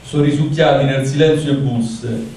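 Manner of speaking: poem recited in Roman dialect; roman accent. Acted. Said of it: "vado direttamente allora in dialetto".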